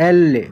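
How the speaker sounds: এল এ said loud and clear, two beats; Italian letter L. Shown as a demonstration. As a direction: stress এল এ with a doubled final eh. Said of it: "Italian বর্ণমালায় আমরা এল এ".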